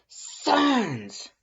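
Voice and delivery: high, loud furious scream. A narrator's reading. Caller on the phone screaming Lust's name, full scream. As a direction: scream his name down the line. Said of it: "Someone screamed".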